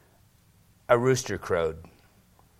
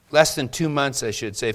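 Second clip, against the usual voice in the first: ordinary delivery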